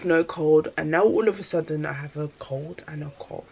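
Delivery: ordinary talk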